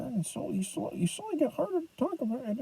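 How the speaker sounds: very soft, mumbled demonstration; fading into mumble. Shown as a demonstration. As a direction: let the words sink almost out of hearing. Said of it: "he gets quieter and quieter".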